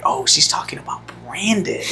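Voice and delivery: whispered aside; hushed realization. Retold or imitated. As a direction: whisper it so they won't hear. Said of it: "And I was like".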